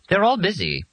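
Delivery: high, even robot voice. A narrator's reading. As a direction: speak like a polite machine.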